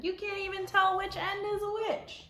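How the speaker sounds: put-on high, loud child's protest. Grandchild telling grandma worms are gross. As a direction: pipe up like an indignant kid.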